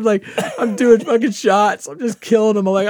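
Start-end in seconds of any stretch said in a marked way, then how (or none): none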